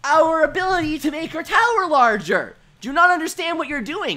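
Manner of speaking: exasperated outburst. Retold or imitated. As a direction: shout at the classmate wrecking the tower. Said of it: "And I'm like".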